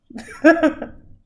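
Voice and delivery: a laugh that tips into crying; tearful laugh. Real, not acted. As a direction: laugh through rising tears. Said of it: "I'm gonna get emotional".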